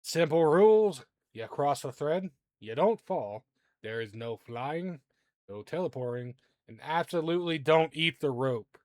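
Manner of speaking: loud beam master calling out the rules; shouted announcement. Acted. Beam master to contestants at the tightrope, shouting out. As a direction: call out the rules loudly to contestants. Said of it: "shouts out".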